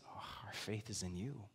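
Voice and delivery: very soft, low confession of trust; hushed devotion. Acted. Disciples saying back to Jesus, hushed and low. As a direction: drop right down to a quiet, low reply.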